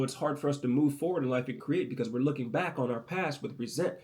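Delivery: usual speaking voice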